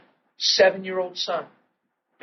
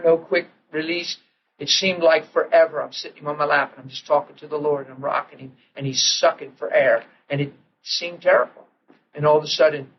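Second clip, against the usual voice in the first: normal talking voice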